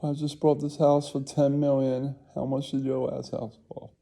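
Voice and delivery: high mock bragging; lightly hammed-up show-off; rich rival.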